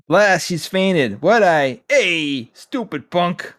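slow, hammy comic-book villain. Pretend. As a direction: ham up the bad guy. Reading Scorpion's lines in voice.

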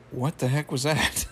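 put-on baffled voice, breaking into laughter; mock bewilderment. Everyone reacts to Rigby's story, mock bewildered. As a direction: act out a weirded-out audience, amused. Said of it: "everyone's just looking like".

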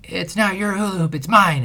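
mock a kid claiming the toy; imitating a yelling kid. Dad imitating neighbor girl yelling over hula hoop. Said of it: "the neighbor girl was yelling".

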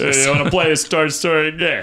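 goofy put-on character voice; Kronk. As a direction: ask it like a big lovable oaf.

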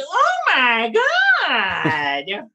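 long, high "oh my" falling onto God; theatrical gasp of admiration, drawn out; hammed-up awe. Drag queen acting a room seeing their entrance, hammed-up awe. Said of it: "first thing everyone thinks is".

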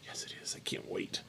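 very soft, voiceless reply; whisper. Pretend. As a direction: whisper back playfully.

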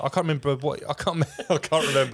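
high, laughing words. Real, not acted. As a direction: giggle through it. Laughing while forgetting.

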